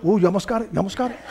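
soft teasing hint of the commercial; Geico ad voice. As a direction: lightly tease like the ad. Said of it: "like that Geico commercial".

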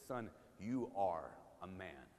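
low, soft, gentle blessing to a son; a father softly telling his son he has what it takes; tender fatherly blessing. Retold or imitated. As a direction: bless him like a proud father.

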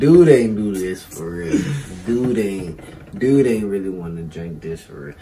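loud, rowdy and tipsy. Real, not acted. Drunk rapper hyped in studio.

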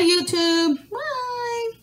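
very high cooing goodbye. Real, not acted. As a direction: coo bye for the pets. Pets say bye, baby talk.

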